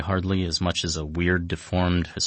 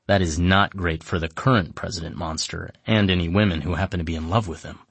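normal talking voice

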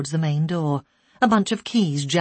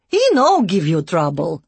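loud, blunt broken-English care worker; broken English, offhand and loud; Eastern European broken English; 7 dB louder than usual. A narrator's reading. Care worker showing a child, loud broken English. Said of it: "in broken English".

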